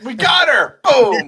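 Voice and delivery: loud, high triumphant cry; excited shout. Retold or imitated. Co-host joining the shootout with a loud triumphant cry. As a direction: yell like you just won a firefight.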